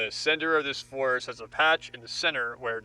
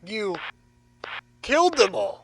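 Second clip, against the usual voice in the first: anguished accusation; 5 semitones higher than usual. Acted. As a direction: hurl the accusation. Captive accuses captor.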